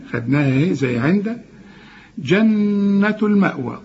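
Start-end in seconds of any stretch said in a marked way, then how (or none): 2.2-3.8 جنة with n held as a long hum; slow nasal hold inside جنة; doubled-noon ghunna. Shown as a demonstration. Teacher demonstrates ghunna, جنة with held n. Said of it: "النون المشددة لازم تغن".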